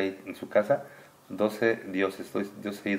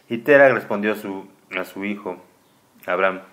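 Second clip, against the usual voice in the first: lectura solemne; 11 dB louder than usual. Acted. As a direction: read it like sacred text to a child. Reads scripture aloud. (lectura solemne en voz alta, más fuerte y pausada)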